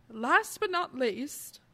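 slow, lightly put-on British accent; a half-joking British accent, drawn out. Slips into a slow mock British accent to close the list. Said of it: "I don't know why I did a British accent".

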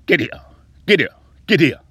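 loud, high mocking command; mock shouted command; barked order. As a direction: yell it like a cop bossing him around. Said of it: "they say".

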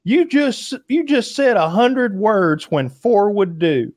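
slow, high imitation of a woman; woman's complaint. Retelling a woman chiding a wordy criminal, high and drawn out. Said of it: "some woman's like".

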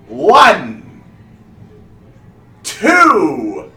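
high, loud, slow stage proclamation; booming wizard showman. Acted. Wizard starting the count for the vanishing trick, booming. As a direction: boom it out, stretching the count.

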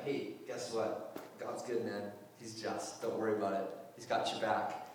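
low, soft, offhand comforting; casual reassurance. Retold or imitated. Paul writing to the persecuted church, casually reassuring. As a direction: reassure them quietly, like a laid-back friend.

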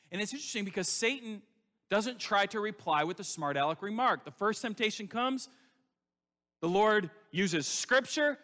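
building preaching. Real, not acted. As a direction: drive it home harder each line like a revival preacher.

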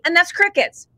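usual speaking voice

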